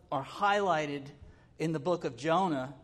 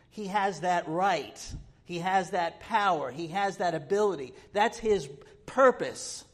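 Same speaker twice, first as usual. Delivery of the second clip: louder and higher with each phrase, building; rising preaching fervor; 3 semitones higher, 6 dB louder than usual. Real, not acted. Preacher proclaiming God's sovereignty to the congregation, building fervor. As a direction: hammer each clause home, climbing.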